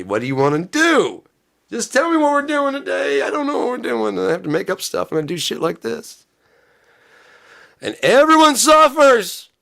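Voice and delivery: high, loud whiny mock yelling; mock tantrum. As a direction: throw a hammed-up fit at chat. Mock tantrum demanding today's plan.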